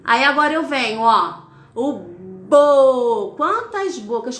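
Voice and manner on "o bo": "bo" drawn out very slowly, lips rounded; stretched syllable bo. Shown as a demonstration. Teacher modeling the syllable for young readers, very slowly. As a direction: hold "bo" long so the mouth moves show. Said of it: "quantos movimentos na boca eu faço".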